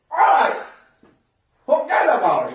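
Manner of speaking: a high, loud retold yell at the boss; half laughing, half scolding the boss. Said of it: "我是跟他笑，跟他骂". (对老板又笑又骂地大喊)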